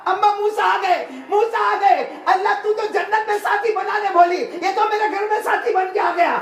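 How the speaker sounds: very high, loud, breathless-with-news cry; overjoyed shouting. Retold or imitated. Son telling his mother Musa has come, overjoyed shouting. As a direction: call it out in excited amazement.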